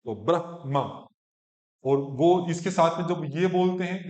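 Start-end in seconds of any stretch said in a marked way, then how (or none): 0.1-1.1 ब्रह्मा slow as "brah-ma"; h then full ma. Shown as a demonstration. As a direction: breathe the h and land ब्रह्मा on a whole ma. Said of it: "म पूरा आएगा".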